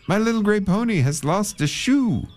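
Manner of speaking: man begging the storekeeper for coal; pleading call. Acted. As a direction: call to the storekeeper high and anxious. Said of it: "he said".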